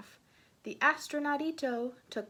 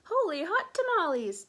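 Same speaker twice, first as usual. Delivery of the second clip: high, loud excited exclamation; astonished storybook outcry; exclaiming; 6 semitones higher, 7 dB louder than usual. A narrator's reading. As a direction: burst out in amazement. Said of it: "exclaimed Skippy John Jones".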